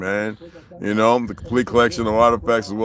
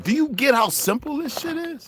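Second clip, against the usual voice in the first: rhetorical challenge. Real, not acted. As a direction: hammer the question home, pitched way up. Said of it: "rhetorical challenge repeated".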